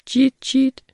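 high chirping birdcall; bird chirp. A narrator's reading. Imitating the bird. (højt fuglepip)

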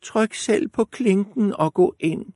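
put-on high frail old woman. A narrator's reading. Grandmother calls through the door, high old voice. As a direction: quaver it like a granny. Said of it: "sagde bedstemoren".

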